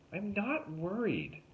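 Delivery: high, slow, insisting she's fine; unconvincing denial; defensive girl. A narrator's reading. Tuna denies fear, high.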